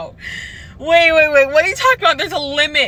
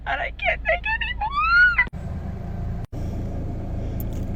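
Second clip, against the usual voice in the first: breaking down; 8 dB quieter, slower than usual. Real, not acted. Breaking down about sixteen years.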